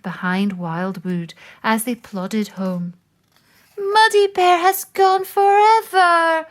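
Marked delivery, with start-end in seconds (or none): 3.8-6.5 crying. Acted. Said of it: "cried Moose".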